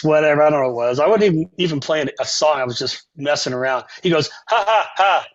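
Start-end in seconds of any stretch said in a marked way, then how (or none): 4.5-5.2 imitated dismissive "ha, ha, ha"; spoken-out laugh, higher pitched; mock laugh. Saxophonist laughs at his playing.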